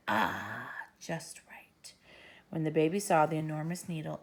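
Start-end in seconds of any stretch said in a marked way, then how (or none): 0.1-1.9 soft, low whispered satisfaction; gleeful hush. Acted. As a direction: relish the enormous needle. Doctor pleased with huge needle.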